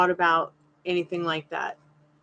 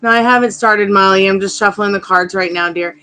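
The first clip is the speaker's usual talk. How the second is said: louder call to someone in another room; calling offscreen; 10 dB louder than usual. Real, not acted. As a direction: call out to Molly in the next room.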